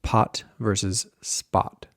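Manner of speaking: slow contrast of aspirated pot and unaspirated spot; aspiration contrast. Shown as a demonstration. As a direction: puff of air on pot, almost none on spot. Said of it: "not nearly as aspirated".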